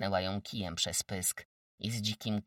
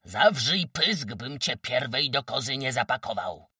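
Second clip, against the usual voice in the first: wójt's angry shout; 3 semitones higher, 8 dB louder than usual. Acted. The village head silencing Kozłowa, angry shout. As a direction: shout her down with authority. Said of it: "krzyknął wójt".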